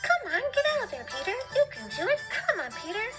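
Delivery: cheering sparrows. Acted. Sparrows egg Peter on, high and excited. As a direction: urge him on like eager birds. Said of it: "implored him to exert himself".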